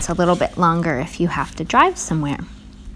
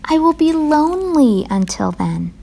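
high, wistful plea, sinking at the end; plaintive Frog; 8 semitones higher than usual. A narrator's reading. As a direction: plead in a high, lonely voice. Lonely Frog pleads with Toad.

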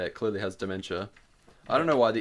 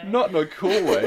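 high, loud, teasing echo; mocking laughing repeat; 10 semitones higher, 8 dB louder than usual. Real, not acted. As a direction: repeat her words through laughter. Mocking friend's defense, laughing.

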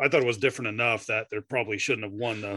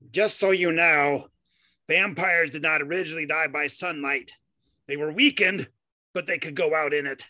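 hammed-up soapbox harangue, pitched up; [name] on the soapbox; 4 semitones higher than usual. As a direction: lecture like a mock pedant on a soapbox. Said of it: "you will recognize this haranguement from the soapbox".

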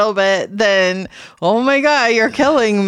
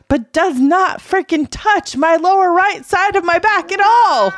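exasperated rant; 8 semitones higher than usual. Real, not acted. Wife complaining to listeners about the masseur, exasperated and rising. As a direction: vent the outrage, pitch climbing. Ranting about ignored sore back.

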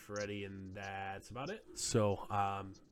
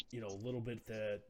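normal talking voice

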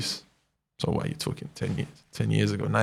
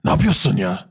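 imitating the coach. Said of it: "That's how he talks".

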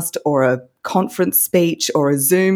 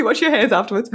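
echoing joke, higher; 6 semitones higher, faster than usual. Real, not acted.

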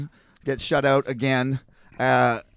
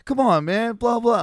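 imitating the pleading drunk; 7 semitones higher, 3 dB louder than usual.

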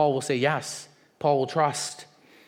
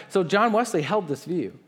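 ordinary delivery

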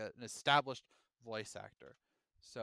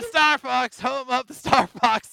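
fast food worker voice; 9 semitones higher, 12 dB louder than usual. Retold or imitated.

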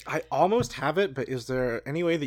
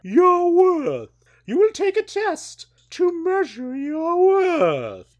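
dramatic godlike judgment; 9 dB louder, slower than usual. Acted. Ellimist delivers judgment dramatically.

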